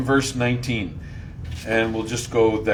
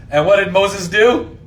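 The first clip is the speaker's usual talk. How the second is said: ordinary talk, nothing marked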